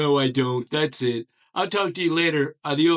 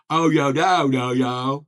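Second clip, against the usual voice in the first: loud, each syllable drawn wide; big-mouth enunciation; wide-mouthed goofy voice; 6 dB louder than usual. Shown as a demonstration. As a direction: mouth stretched wide, booming out every syllable. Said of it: "I've lowered my voice".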